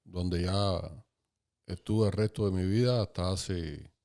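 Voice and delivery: ordinary talk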